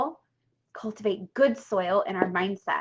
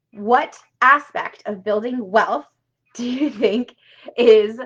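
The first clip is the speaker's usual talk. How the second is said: ordinary delivery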